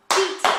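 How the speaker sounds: titi high and loud; rhythm syllable. Shown as a demonstration. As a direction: pop out titi quick. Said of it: "is going to go into one beat".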